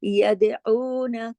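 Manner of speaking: यदऊना with the ऊ held two beats; long oo. Shown as a demonstration. Teacher shows the two-beat vowel. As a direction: stretch the ऊ in यदऊना. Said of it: "ऊ को दो हरकत".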